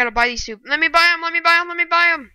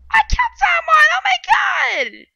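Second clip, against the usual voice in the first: high shouted disbelief; squealing victory yell; ecstatic shriek. Real, not acted. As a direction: scream with joy at the kill. First kill in game.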